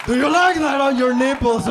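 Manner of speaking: high, very loud strained yell; hammed-up shouting lover; frantic shouted question. Short man calls up to his tall lover, shouting frantically. Acted frantic bedroom scene.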